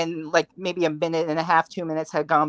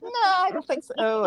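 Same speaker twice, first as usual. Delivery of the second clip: much higher, dismissive voice of the actress; mock actress. Retelling the actress answering the fan at Q and A, high and dismissive. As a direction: brush the question off in a high voice. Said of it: "And she's like".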